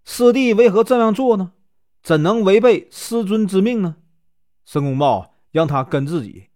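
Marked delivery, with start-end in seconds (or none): 0.1-4.0 high-pitched, taken-aback questions; mildly shocked storyteller's protest; startled questioning. A narrator's reading. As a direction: question a junior brother in surprise. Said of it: "吃了一惊".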